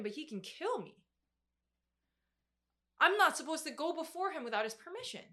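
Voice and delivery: ordinary talk, nothing marked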